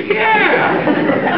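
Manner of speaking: very high, delighted Irish cry; hammed-up high Irish brogue; drunk Irishman. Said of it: "the Murphy twins are drunk again".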